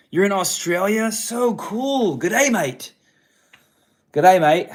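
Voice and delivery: high, loud, hammed-up Aussie greeting; butchered Australian accent. Host greeting a viewer in Australia, hammed-up Aussie accent. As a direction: put on an excited mock Australian accent. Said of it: "I'm butchering an accent right now".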